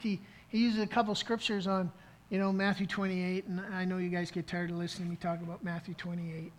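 normal talking voice

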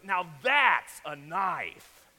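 loud, slow punch on THAT'S; Crocodile Dundee. Acted. Dundee pulls out his huge bushwhacker, loud and slow. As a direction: draw it out big and loud, no accent attempted. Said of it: "He goes".

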